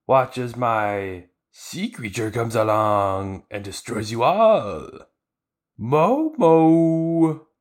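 hammed-up octopus fishman gloating; cartoon villain calling up his sea monster; Hachan the octopus. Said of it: "Hachan, the octopus guy, who's like".

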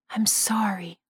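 a breathless ghostly whisper, no voice at all; whispered apology. A narrator's reading. Ghostly father whispering sorry to his son.

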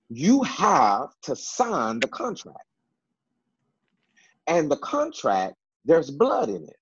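slow, rising preacher's cadence, pitch climbing to a peak; preaching build. Acted. To the viewers about signing the contract, in a slow rising preaching build. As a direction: build it like a pulpit point.

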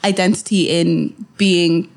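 normal talking voice

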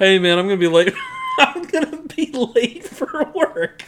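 mock panicked call, laughing; laughing imitation. Friend mimicking the late phone call, laughing through it.

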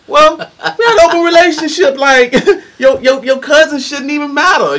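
high laughing mock excuse.

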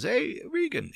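a light take on a calm boss greeting you; calm Swiss boss. Retold or imitated. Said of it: "Swiss guy, he's very calm".

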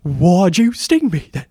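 high, loud, slow mocking mimicry; pitched over-the-top impression. Co-host mocks the actor's awful line reading, over-the-top impression. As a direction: belt it out high, hamming up every word. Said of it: "It's that really pitched, over the top".

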